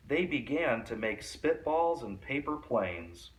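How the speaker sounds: ordinary delivery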